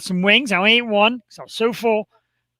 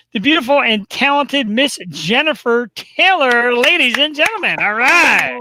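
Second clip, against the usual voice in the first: louder, higher, drawn-out build; announcer introduction; 5 semitones higher, 5 dB louder, slower than usual. Real, not acted. Host bringing on the returning guest, big announcer introduction. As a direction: present the star with showman's flourish.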